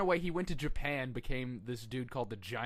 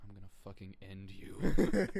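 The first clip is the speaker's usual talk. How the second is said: menacing threat; 5 semitones lower, 3 dB louder than usual. Retold or imitated. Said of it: "Brock Lesnar was like".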